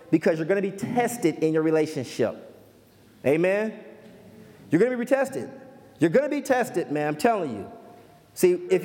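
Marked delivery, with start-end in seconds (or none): none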